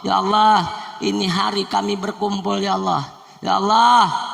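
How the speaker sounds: pleading prayer calls building in force; fervent prayer. Acted. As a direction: lead the prayer with rising fervour, Ya Allah ringing out. Leads the congregation in prayer.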